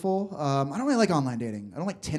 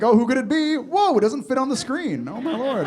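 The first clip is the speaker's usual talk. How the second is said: higher-pitched, hammed-up astonishment; mock shock; 7 semitones higher, 4 dB louder than usual. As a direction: act stunned by what pops up. Acts out shock at the messages.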